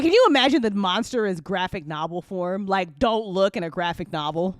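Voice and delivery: normal talking voice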